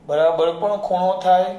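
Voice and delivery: normal talking voice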